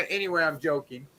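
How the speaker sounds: ordinary talk, nothing marked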